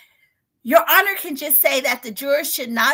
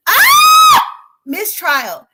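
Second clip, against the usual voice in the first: sudden high yell of mistrial; excited shout; 13 semitones higher, 13 dB louder, much slower than usual. Real, not acted. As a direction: burst out shouting the word. Reacting live to courtroom updates.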